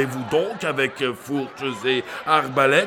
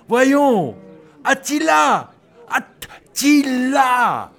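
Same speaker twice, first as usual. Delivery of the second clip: loud high drawn-out call; 8 semitones higher, 4 dB louder, slower than usual. Acted. Crier prompts them loudly.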